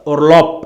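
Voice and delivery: "Orlop" with the last sound a hard P; loud "Orlop", stopped on a P; final B as P. Shown as a demonstration. Teacher confirming the ending rule, final B as P. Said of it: "ending B spelt as P".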